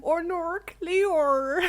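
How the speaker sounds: "Or nor, Cleor" with drawn, rounded vowels; mock Australian accent. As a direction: play up an Australian accent, a bit high. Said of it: "messing around with that accent".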